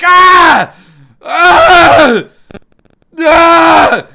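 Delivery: three loud, high screams of rage; frantic screams. Retold or imitated. Frank Grimes losing his mind at Homer, screaming. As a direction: scream like you are losing it. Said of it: "He's like".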